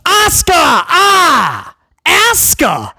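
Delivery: lightly mocking commentator imitation; mocking British call. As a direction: mimic Barrett calling her name slowly. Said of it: "You can't go "Ah".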